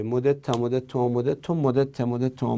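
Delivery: مدد ته and مدد تو run together as a chant; rattled-off drill. Shown as a demonstration. Teacher reciting the unmerged verb table, in a quick low-key run. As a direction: recite مدد ته، مدد تو briskly, without gaps. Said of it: "نمیشه".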